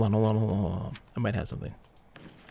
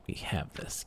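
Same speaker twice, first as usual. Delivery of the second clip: whispered to himself under breath; hushed self-assurance; 9 dB quieter, slower than usual. Real, not acted. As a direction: breathe it, barely voiced. Quiet tense muttering to self.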